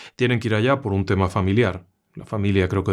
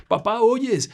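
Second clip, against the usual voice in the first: much higher, pleading repeat; pleading scared girl; 11 semitones higher, much slower than usual. Acted. Frightened girl begs her father to hear, much higher. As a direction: plead with him now, pitch jumping up. Girl asks again, more urgently.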